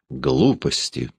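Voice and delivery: slow, odd ringing tone; strange ringing voice. Acted. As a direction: say it in a voice that sounds not your own. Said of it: "странно звенящим голосом".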